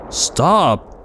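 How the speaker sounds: sudden high frightened cry; startled shout. Real, not acted. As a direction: yelp it in alarm.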